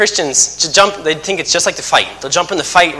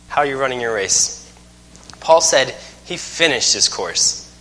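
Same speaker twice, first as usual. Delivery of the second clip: normal talking voice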